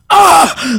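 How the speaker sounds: high, loud mock cry of shock; shocked gasping cry. Retelling the Sprinter remark to friends, shocked cry. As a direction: let out an acted, high "ah" of disbelief. Acts out shocked reaction in anecdote.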